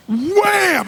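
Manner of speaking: a sharp, high, loud "wham!" as the blow lands. Retold or imitated. Retelling the hit on the chairman, a loud high wham. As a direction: slam the sound out like a punch. Acting out hitting the man.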